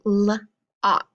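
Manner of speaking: "l-, ok" split into first sound and rime. Shown as a demonstration. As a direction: break lock into "l-" pause "ok". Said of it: "spell the word lock".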